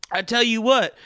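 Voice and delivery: ordinary talk, nothing marked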